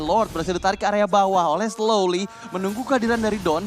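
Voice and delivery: ordinary delivery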